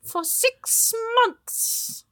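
high, stern witch voice; witchy voice. Acted. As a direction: scold like a fussy witch.

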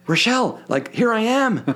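calling across the plane, pitched high; mock shout to get his wife's attention; mock yell. Husband calling to his wife across the plane, high mock yell. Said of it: "And I yell".

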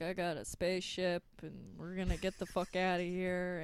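dazed mumble; mumbled mock Goku. Dazed hero announcing the escape, soft and dull. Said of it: "Goku's like".

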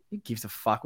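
normal talking voice

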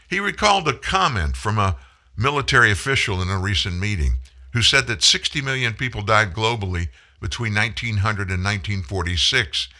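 ordinary delivery

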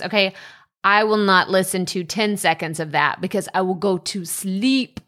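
ordinary talk, nothing marked

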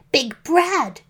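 high, mildly scolding call; angry shout. A narrator's reading. Little Red unmasking the wolf, shouting angrily. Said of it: "she shouted angrily".

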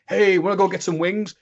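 high-pitched, hammed-up eager offer; overfriendly invitation. Acts out his overfriendly invitation.

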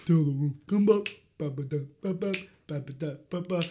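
ordinary talk, nothing marked

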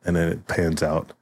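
usual speaking voice

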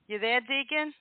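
ordinary talk

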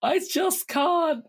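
high, whiny mock despair; mock despairing Craig. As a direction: wail it high and defeated. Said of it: "Craig, during his wobble, is also like".

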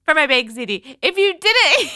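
high-pitched words breaking into laughter; can barely talk for laughing; giggling. Real, not acted. Host laughing at herself for reading the whole recipe. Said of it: "I can't stop laughing now".

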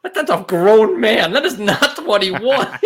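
slow, drawn-out disbelief; incredulous aside. Retold or imitated.

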